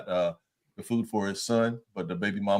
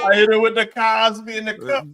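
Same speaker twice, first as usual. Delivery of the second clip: laughing shout, much higher; loud joking shout; 12 semitones higher, 7 dB louder than usual. Real, not acted. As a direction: shout the punchline through a laugh. Joking boast about first date.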